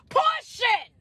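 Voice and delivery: high, shouted climax word; emphatic shout. Real, not acted. Coach landing the refrain for followers, emphatic shout. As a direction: shout it as the preacher's punchline.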